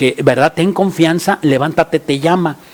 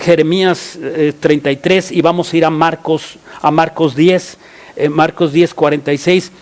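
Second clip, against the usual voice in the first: ordinary delivery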